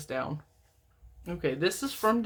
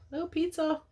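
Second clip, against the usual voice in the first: surprised pizza; 10 semitones higher than usual. Real, not acted. Reacting to TV pizza commercial.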